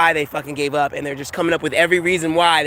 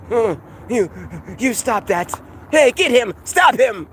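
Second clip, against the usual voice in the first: mock agents chasing; 4 semitones higher, slower than usual. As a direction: bark like a Matrix agent raising the alarm. Matrix agents chasing him.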